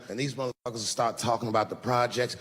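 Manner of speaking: ordinary delivery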